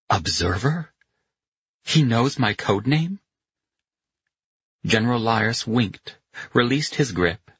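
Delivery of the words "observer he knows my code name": puzzled question, rising at the end; disbelief. A narrator's reading. Pino stunned by the general's parting word, in disbelief. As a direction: echo it in disbelief, lifting higher. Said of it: "stared at him in disbelief".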